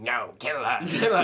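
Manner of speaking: lightly voiced demon, breaking into laughter. Co-host mimics possessed man in church, mock demon voice. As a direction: put on a bit of a demon voice. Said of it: "whilst doing a demon voice".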